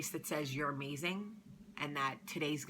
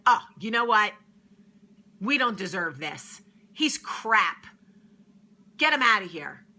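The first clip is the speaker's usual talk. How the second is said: loud, higher indignant yelling; furious big sister; 5 semitones higher, 11 dB louder than usual. Retold or imitated. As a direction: throw him out, outraged. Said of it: "She's like".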